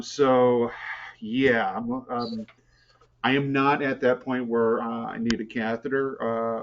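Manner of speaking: ordinary talk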